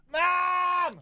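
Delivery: high, drawn-out holler; mock kid's yell. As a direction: mimic a kid yelling for a parent. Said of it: "back to when you were a kid".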